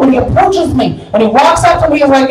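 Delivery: usual speaking voice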